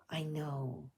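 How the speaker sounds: a soft, hushed reply; softly. A narrator's reading. Annie answers the little girl in bed, softly. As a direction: say it quietly, half to herself. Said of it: "said Annie softly".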